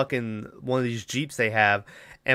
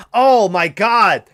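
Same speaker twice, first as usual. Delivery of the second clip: sudden exclamation; 7 semitones higher, 7 dB louder, slower than usual. Real, not acted. Sudden outburst at worst part.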